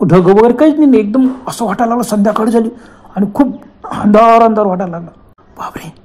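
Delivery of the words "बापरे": much softer, barely voiced gasp; scared hush. Hikers as darkness falls at midday, hushed scared gasp. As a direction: let it slip out hushed with dread.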